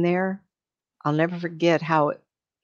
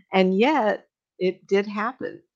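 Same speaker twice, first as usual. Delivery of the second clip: ordinary delivery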